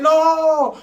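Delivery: high, loud shocked no; a hammed-up horrified no; mock shock. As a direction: gasp it out in disbelief. Shocked at confession.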